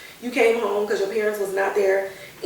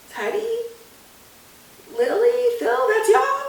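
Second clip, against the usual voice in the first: put-on high, wondering voice of a lost boy; trapped kid calling out to his friends; ghost boy voice; 13 semitones higher than usual. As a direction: sound like a boy calling from inside the film. Ghost Maddie recognizes his friends.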